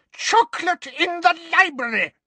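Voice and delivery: put-on scandalised old librarian screeching; screaming. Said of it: "she screamed".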